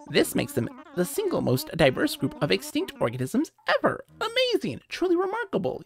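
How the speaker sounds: an eager bird lecturing on fossils; lecturing owl curator. Acted. Owl curator explaining the trilobite, lightly high professorial voice.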